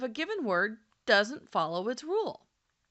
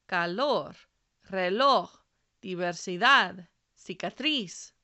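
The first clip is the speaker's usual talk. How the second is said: calor, reloj, diversidad, cicatriz, each stressed on its final syllable; last-syllable stress; 3 semitones lower, 3 dB louder than usual. Shown as a demonstration. Teacher showing learners the second rule, calor, reloj, diversidad, cicatriz stressed on the end. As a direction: stress ca-LOR, re-LOJ, diversi-DAD, cica-TRIZ, one word at a time. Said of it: "then the stress is on the last syllable".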